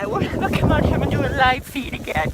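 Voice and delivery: loud furious rant at viewers; angry shout. Acted. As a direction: yell the curse, swelling at the end. Oh, I hate you all.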